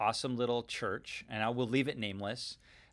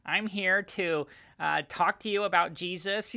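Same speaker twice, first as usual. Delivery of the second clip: imitating Paul in a much higher, thinner voice; high-voiced Paul; 7 semitones higher than usual. Pastor mocking Paul's imagined voice to the congregation, high-pitched impression. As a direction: play the apostle as a timid little guy. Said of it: "Paul had a higher voice".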